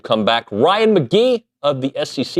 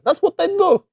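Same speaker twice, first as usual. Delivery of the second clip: much higher put-on twang; accidental accent. Host catching himself in an accent, high twang. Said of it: "Why did I say that with an accent?".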